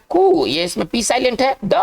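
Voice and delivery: कु held long as its own syllable; pronunciation demo. Shown as a demonstration. Teacher stressing that pronunciation matters, कु sounded out alone. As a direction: isolate कु and stretch it. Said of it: "इसका उच्चारण बहुत मायने रखता है".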